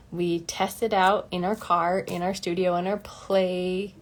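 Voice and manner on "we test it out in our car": ordinary talk, nothing marked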